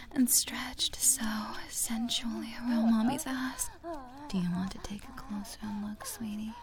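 soft intimate whisper; breathy ASMR whisper. Acted. Erotic roleplay whisper.

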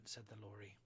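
ordinary delivery, nothing marked

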